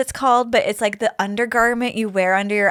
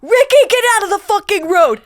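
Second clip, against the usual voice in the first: raised, high imitation of a mother's yell; Boston mom yelling; 8 semitones higher, 6 dB louder than usual. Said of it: "I saw a woman yell at her toddler in Boston".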